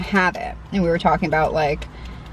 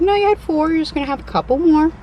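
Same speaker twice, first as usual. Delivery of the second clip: high imitating voice; 8 semitones higher than usual. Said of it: "She's like".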